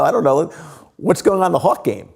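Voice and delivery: cheerfully dodging the question; breezy deflection. Retold or imitated. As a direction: brush it off and change the subject. Deflecting wife with sports.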